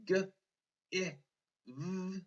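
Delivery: G as "guh", I as "ih", V as a held buzz; finger-spelled sounds. Shown as a demonstration. Teacher finger-spelling give, sounded out. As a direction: sound out G, I, V with pauses between. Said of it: "If I finger spell, I hear".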